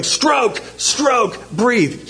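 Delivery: high called-out swim cues; mock coaching yell. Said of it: "I'm gonna yell".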